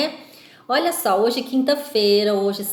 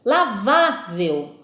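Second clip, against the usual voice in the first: Lavável slowed down, VÁ long and strong; stressed middle syllable; much slower than usual. Shown as a demonstration. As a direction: stretch the VÁ of Lavável for the class. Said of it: "a sílaba tônica".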